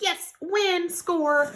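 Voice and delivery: high-pitched mock celebration; acted triumph. Treasure hunter acting out finding something good, excited.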